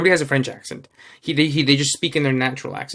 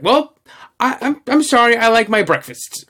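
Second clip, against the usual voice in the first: high, louder imitation of a defensive husband; mock bickering; 8 semitones higher, 4 dB louder than usual. Imitating Napoleon answering Josephine at the dinner table, bickering. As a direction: protest it in hammed-up indignation. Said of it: "they're at a dinner table and they're bickering".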